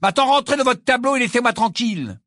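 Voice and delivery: exasperated order, high and loud; furious. A narrator's reading. Ron orders the portrait back into its frame, furious. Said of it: "d'un ton furieux".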